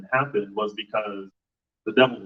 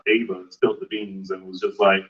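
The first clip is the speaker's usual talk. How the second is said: normal talking voice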